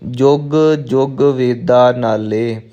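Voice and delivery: slow line, each ਜੁਗ on its own; separated words. Shown as a demonstration. Teacher modelling for learners, slow line with ਜੁਗ ਜੁਗ apart. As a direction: space out ਜੁਗ ਜੁਗ, reciting slowly. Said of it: "ਇਕੱਠਾ ਨਹੀਂ ਕਹਿਣਾ".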